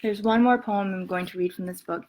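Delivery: normal talking voice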